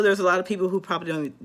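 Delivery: usual speaking voice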